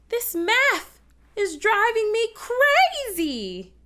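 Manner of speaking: high, loud, slow, "crazy" drawn out; hammed-up despair landing on "math" and "crazy"; stress on MATH and CRAZY. Shown as a demonstration. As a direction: wail "math" and "crazy" melodramatically. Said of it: "what words you think I'm really accenting".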